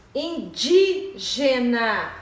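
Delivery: "Indígena" very slow, strong on DÍ; syllable by syllable "Indígena", stressed second syllable. Shown as a demonstration. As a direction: hit the DÍ of "Indígena". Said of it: "E a terceira".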